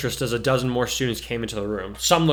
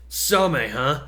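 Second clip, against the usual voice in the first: scornful snort; 3 semitones higher, much slower than usual. Acted. Rude classmate mocking a rival's fancy school, scornful. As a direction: sneer at the school's name. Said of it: "the blonde boy snorted".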